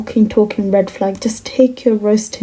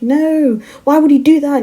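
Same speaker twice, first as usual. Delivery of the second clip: higher, louder imitated protest; mock objection; 6 semitones higher, 4 dB louder than usual. Imitating a boyfriend objecting to her job, indignant.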